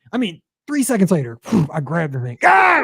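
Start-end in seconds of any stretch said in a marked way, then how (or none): 2.4-2.8 sharp high yelp of pain; startled yelp. Real, not acted. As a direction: yelp as the hot dish burns. Grabs hot pipkin, yelps.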